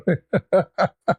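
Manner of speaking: a high, helpless burst of laughter. Real, not acted. Laughing at the stunned man in the elevator, high helpless laugh.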